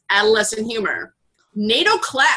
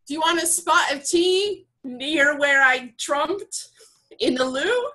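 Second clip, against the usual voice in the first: higher, mock British tea-time voice; put-on British accent; 4 semitones higher than usual. Host uses trumped in a sentence on request, mock British accent. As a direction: put on an amateur British accent, asking politely. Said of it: "I need an accent here".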